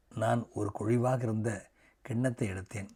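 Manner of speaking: ordinary delivery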